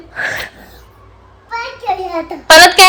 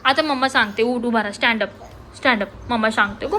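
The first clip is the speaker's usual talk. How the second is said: much softer, lower coax; mother softly coaxes upset son; 6 semitones lower, 12 dB quieter than usual. Real, not acted. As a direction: gently talk him up off the floor. Coaxing child softly.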